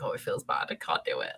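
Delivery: hushed, scandalized murmur; half-whispered prudish fretting; mock pearl-clutching. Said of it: "I was clutching my pearls".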